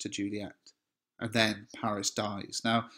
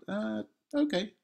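meek giving in; 11 semitones higher than usual. Pretend. Teacher imagines being Paris.

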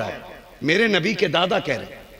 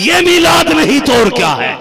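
loud, much higher triumphant cry; rousing climax; 13 semitones higher, 7 dB louder than usual. Real, not acted. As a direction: hit the peak of the sermon. Preacher climaxes the point. (ऊँची आवाज़ में जोशीला सवाल)

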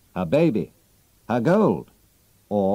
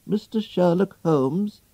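put-on high, slow voice of an old maid; a polite old servant asking at the door; old servant opening the door to Holmes, high and slow; old servant woman; 9 semitones higher than usual.

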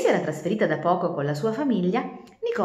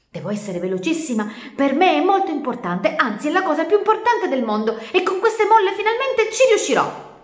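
eager insistence; 10 semitones higher, 7 dB louder than usual. Acted. Turtle insists to calf.

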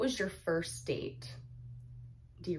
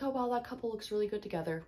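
higher, quick gushing onlooker; imitated admirer; 5 semitones higher, much faster than usual. Imitating what partner would say about couples, mock admiring. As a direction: mimic someone admiring a couple, high and fast. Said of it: "You'll be like".

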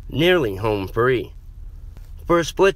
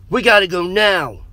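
high, loud, pressing plea; urgent cry; 10 semitones higher, 5 dB louder than usual. A narrator's reading. Dante presses friends to flee the horde, urgent and loud. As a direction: push them to move at once.

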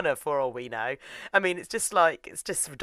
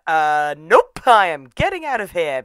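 much louder, emphatic nope; mock alarmed refusal; 12 dB louder than usual. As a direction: blurt it loudly like a play-acted escape. Acted alarmed refusal and escape.

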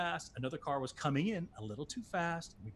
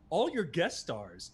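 ordinary talk, nothing marked

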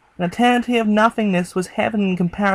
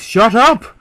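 loud, desperate yell; scream at the top of the voice; 2 semitones higher, 6 dB louder than usual. A narrator's reading. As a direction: let it rip at the unseen man. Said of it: "I screamed at the top of my voice".

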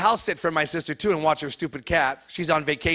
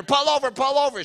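yelling; 7 semitones higher than usual. Retold or imitated. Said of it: "I'm yelling at her".

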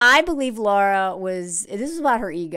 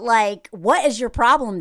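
normal talking voice

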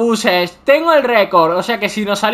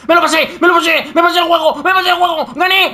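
triumphant shouting; 9 semitones higher, faster than usual. Real, not acted. Gamer shouts to his friends that he beat the game, jubilant yells. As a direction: yell the win out in pure triumph. Finally beats the level.

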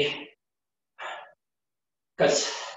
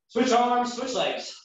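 coaching shout; 8 semitones higher, much slower than usual. Real, not acted. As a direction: call it out high. Trainer calling out cue.

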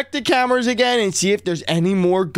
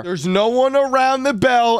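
usual speaking voice

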